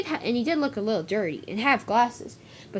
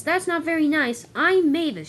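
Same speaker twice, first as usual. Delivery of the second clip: higher-pitched, mildly cross retort; indignant correction, pitched up; 6 semitones higher, 3 dB louder than usual. A narrator's reading. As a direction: stand up for yourself, a bit annoyed. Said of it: "she said crossly".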